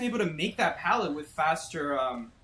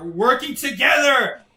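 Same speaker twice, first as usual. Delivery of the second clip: loud, higher, rising disbelief; outraged yelling; 9 semitones higher, 12 dB louder than usual. Real, not acted. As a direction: rant louder and higher each line. Outraged at teaming survivors.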